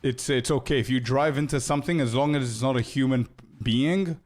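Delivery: usual speaking voice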